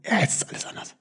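whispered, soft waking-up thought; soft whispered thought after a long sleep; hushed inner thought. Retold or imitated. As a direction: breathe it like a sleepy realisation. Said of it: "denkt so".

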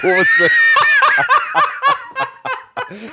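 high shrieking laugh; loud burst of laughter. Real, not acted. Big laugh after joke. (lautes Gelächter)

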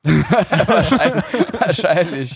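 laughing through the words; real laugh breaking the words. Real, not acted. Laughing at a joke about beer.

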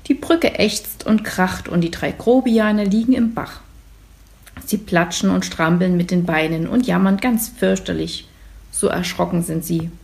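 usual speaking voice

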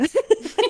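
high burst of laughter. Real, not acted. Laugh after joke. (hohes Lachen)

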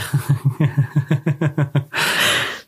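rapid run of real laughter; long chuckle. Real, not acted. Friend reacting to the stolen bike story, long chuckle. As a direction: chuckle on and on at the punchline.

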